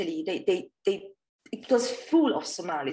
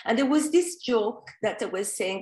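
normal talking voice

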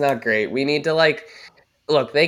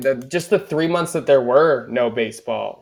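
ordinary talk, nothing marked

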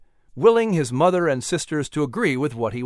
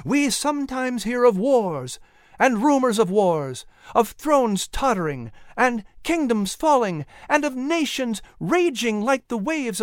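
flourish of emphasis; 6 semitones higher than usual. Acted. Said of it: "a flourish of emphasis".

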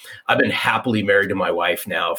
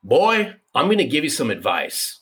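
high-pitched mock "boy" opening; imitated Southern mother-in-law; 7 semitones higher than usual. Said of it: "my mother-in-law goes".

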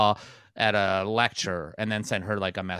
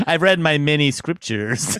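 slow, higher and louder mock pronouncement; mock pompous scholar; 5 semitones higher, 5 dB louder than usual. Mocking Bill Gates justifying himself with science, slow pompous mock voice. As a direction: ham up a self-important know-it-all, slowly.

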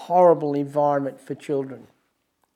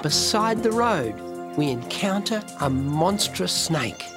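excited wildlife host whisper; 3 semitones higher than usual. Acted. Wildlife host piece to camera.

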